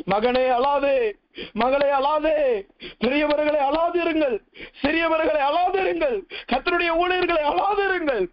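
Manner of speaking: preacher's chant-like appeal, climbing in pitch; fervent exhortation. Real, not acted. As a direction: build phrase by phrase, calling to each group. Preacher exhorts each group. (உச்சத்தை நோக்கி உயரும் உருக்கமான பிரசங்க அழைப்பு)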